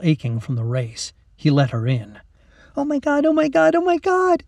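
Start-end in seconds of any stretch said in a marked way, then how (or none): 2.8-4.4 very high, squealed excitement; giggly schoolgirl thrill; giddy. A narrator's reading. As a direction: squeal it in one breathless rush. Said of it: "she giggled".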